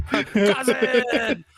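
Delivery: loud, high mock hail; mock calling out. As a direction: call out big, as if to long-lost kin.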